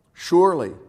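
high, loud exclamation; awed exclamation. A narrator's reading. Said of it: "they were terrified and exclaimed".

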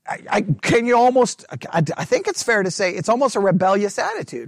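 normal talking voice

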